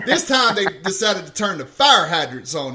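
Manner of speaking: ordinary delivery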